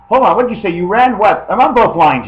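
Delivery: loud, high acted interruption; flustered phone caller. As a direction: break in loudly, juggling two calls. Acting out a muddled phone call.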